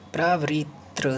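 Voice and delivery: प्रावृत्र stretched slowly, ending on त्र; teacher showing the mistaken प्रावृत्र; wrong form slowly. Shown as a demonstration. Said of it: "प्रावृत्र नहीं होगा".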